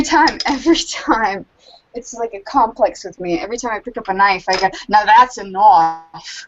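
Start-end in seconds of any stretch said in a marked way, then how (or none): 4.9-6.5 put-on loud, drawn-out Australian accent; hammed-up Australian, stretching it slowly. Every time she picks up a knife, hammed-up Australian accent. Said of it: "is, is Australian".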